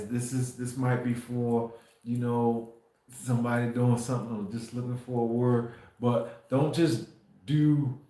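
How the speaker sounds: normal talking voice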